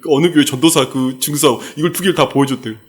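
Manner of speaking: usual speaking voice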